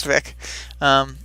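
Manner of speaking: ordinary talk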